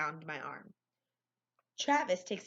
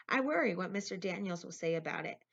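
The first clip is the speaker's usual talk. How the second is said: ordinary delivery, nothing marked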